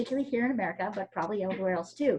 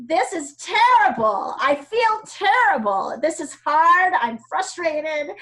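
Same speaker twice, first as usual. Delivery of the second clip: whiny complaining students, higher, louder and slow; mock frustrated students; 6 semitones higher, 7 dB louder, slower than usual. Teacher mimicking her angry students, mock frustrated whine. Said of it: "They were like".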